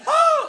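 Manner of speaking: high, loud whooping laugh; whooping crane laugh. Shown as a demonstration. Comedian shows audience a bird laugh, whooping crane call. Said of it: "like a whooping crane".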